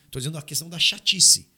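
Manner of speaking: ordinary talk, nothing marked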